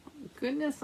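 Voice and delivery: usual speaking voice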